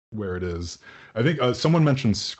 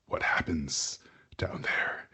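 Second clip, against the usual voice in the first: telepathic whisper; 5 semitones lower, 7 dB quieter than usual. Acted. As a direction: whisper it eerily into their minds. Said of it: "you'll all hear a message inside of your head".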